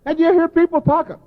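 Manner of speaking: normal talking voice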